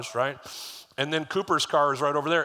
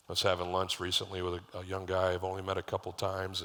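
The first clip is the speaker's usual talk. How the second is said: ordinary delivery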